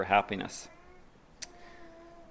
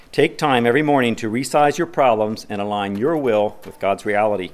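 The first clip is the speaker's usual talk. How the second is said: ordinary talk, nothing marked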